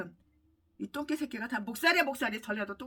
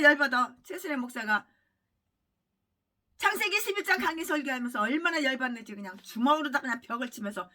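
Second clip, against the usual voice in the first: ordinary delivery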